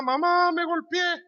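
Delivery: put-on high-pitched whining child; a little kid running to mom after a fall; crying little boy. Crying boy runs to mother. (niño llorando a su mamá con voz aguda)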